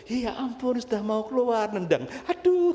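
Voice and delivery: high-pitched mock astonishment; kaget gemas. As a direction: exclaim as if the baby just kicked, rising high. Exclaiming at the kicking baby. (seru kaget bernada tinggi)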